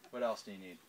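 ordinary talk, nothing marked

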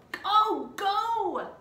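put-on high, eager urging of a little girl; impatient little sister hurrying him off; Annie urging. A narrator's reading. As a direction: shoo him along in a high kid's voice. Annie urges Jack, voiced high.

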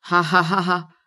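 spaced-out ha-ha laugh; compulsive acted laugh. Else laughs lying on the floor, compulsive laugh. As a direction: laugh in separate, mechanical beats. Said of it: "Ich muss nur immer lachen".

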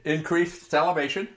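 normal talking voice

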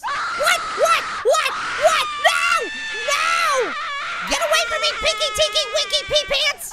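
loud, high, frantic yelling; panicked shouting. Real, not acted. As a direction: yell in alarm while fleeing a monster. Chased by screaming monster.

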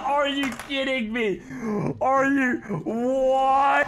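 stunned disbelief. Real, not acted. Disbelief at game result.

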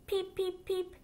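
high squeaky little duckling peeps; baby duck peeping. Acted. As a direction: chirp it out high like a tiny duck. Baby duck's peeps in story.

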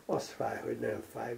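unvoiced, soft inner voice; inner thought. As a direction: think it quietly to yourself. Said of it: "gondolta Gerendás".

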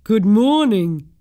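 slow, loud, booming giant hello; a slow, loud gentle-giant good morning; big bloke's greeting. Acted. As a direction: boom it out like a huge friendly giant. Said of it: "The big bloke said".

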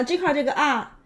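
ordinary talk

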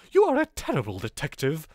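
put-on posh suspect; posh accent. Posh suspect insulting the detective, posh accent. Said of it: "He should be really posh".

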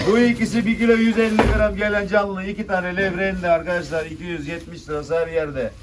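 loud auction call; auctioneer. Real, not acted. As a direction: call the lot to the room. Calling rising bids.

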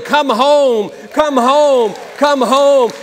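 loud, pleading call repeated. Acted. God calling the wanderer back, loud and pleading. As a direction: call them home at the top of your voice.

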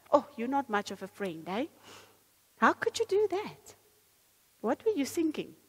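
high, loud scolding reproach; accusing inner voice. Acted. Preacher voicing condemnation to a friend, loud and scolding. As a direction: scold like a disappointed judge. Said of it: "Do you know the voice that says".

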